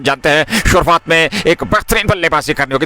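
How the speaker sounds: ordinary talk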